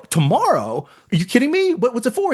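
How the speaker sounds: very high, astonished; can't believe another audition; disbelief. Retold or imitated. Shocked at audition next day.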